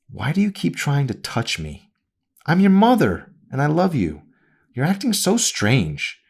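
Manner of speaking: a wounded mother insisting on her love; mother's voice. A narrator's reading.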